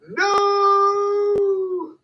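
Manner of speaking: piercing high shout of no. Shown as a demonstration. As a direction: yell it out, way up high. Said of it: "everybody saying no".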